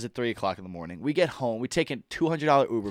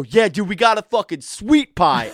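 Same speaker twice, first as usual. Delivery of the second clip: proud announcement; 6 semitones higher, 5 dB louder than usual. Retold or imitated. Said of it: "And I go".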